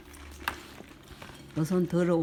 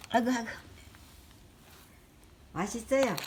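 normal talking voice

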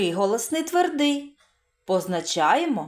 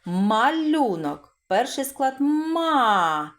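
slow, drawn-out teacher model; slow sound-out; 3 semitones higher, slower than usual. Shown as a demonstration. Teacher slowly models малюнок for the class. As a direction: draw the word out slowly for children. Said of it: "І так, починаємо".

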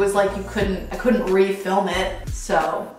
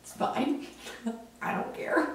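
whispering; 3 semitones higher, 13 dB quieter than usual. Real, not acted. Shrugging off the insults.

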